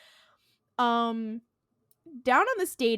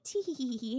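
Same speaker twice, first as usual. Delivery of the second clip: high, soft spoken tee-hee; imitated dainty titter, pitched up; mock giggle; 5 semitones higher, 5 dB quieter than usual. Dean gloating at the trickster, mock giggle.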